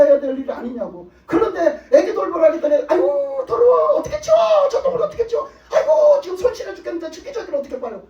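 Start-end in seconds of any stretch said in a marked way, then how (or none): 2.9-8.0 mock high squeal of disgust, much higher and louder; disgusted whiner. Preacher mimics a believer who balks at changing dirty diapers, squealing in disgust. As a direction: squeal like someone grossed out by a diaper. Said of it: "이러면 어떻게 되겠냐고".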